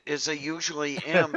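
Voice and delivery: usual speaking voice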